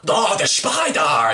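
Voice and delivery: slow, hammed-up fake Russian accent; mock a terrible Russian accent, dragging the words; cartoonish Russian accent; bad Russian accent. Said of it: "can't do a Russian accent. There he is".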